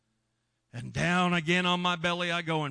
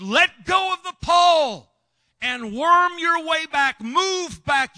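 high, loud, slow shouted commands; hollering orders; 13 semitones higher, 11 dB louder, slower than usual. Retold or imitated. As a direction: bellow the orders down the drain.

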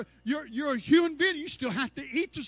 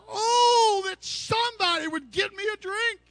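longing cry; 7 semitones higher, 3 dB louder than usual. Acted. Preacher voicing thirsty longing.

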